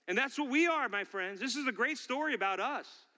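high, driving sermon delivery; impassioned preaching. Real, not acted. Pastor to congregation on David's faith in God, impassioned preaching. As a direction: preach it with building fervour.

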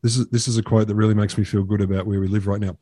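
normal talking voice

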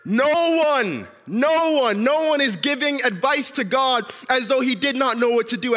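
high, loud, emphatic call; shouted answer. Shown as a demonstration. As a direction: belt out the answer, then press on at high pitch.

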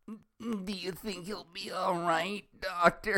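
Velma voice. Shown as a demonstration. Velma asking the doctor about Rogers, high and slow. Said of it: "how do you sound like Velma".

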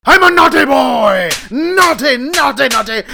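put-on high shrieking naughty boy; shouting. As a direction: yell it hysterically, fading.

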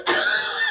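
high squealing laugh. Retold or imitated. Retelling the Sheffield girl's blunder with a high squeal.

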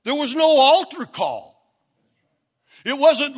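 normal talking voice

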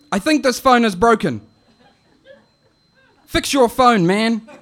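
high, loud auntie calling and fussing; an impatient auntie demanding the boy pick up; auntie yelling down the phone. Retold or imitated. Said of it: "it goes like this".

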